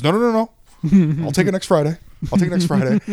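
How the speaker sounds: insistent refusal. Retold or imitated. Dad turns down early cash from the guy, insistent refusal.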